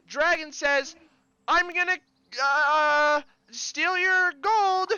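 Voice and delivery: high, loud game-host call; rally the crowd like a hype man; rowdy guard. Acted.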